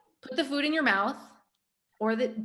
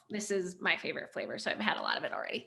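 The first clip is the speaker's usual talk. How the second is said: quick whispered confession; whispered aside; 4 semitones lower, 8 dB quieter, faster than usual. Real, not acted. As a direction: whisper it fast, half to yourself.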